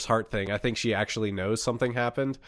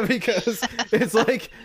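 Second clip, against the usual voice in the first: laughing; 10 semitones higher, slower than usual. Real, not acted. Laughing after the shriek.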